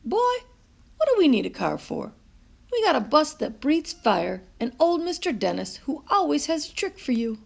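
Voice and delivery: high, playful Nana with a lively start; grandmother voice. A narrator's reading. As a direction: tease the boy out of his sulk.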